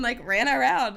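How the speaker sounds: ordinary talk, nothing marked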